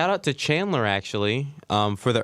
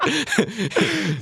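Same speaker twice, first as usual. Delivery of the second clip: high squeak. Acted. Mock lizard sound follows.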